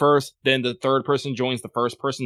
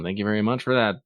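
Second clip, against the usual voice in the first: ordinary talk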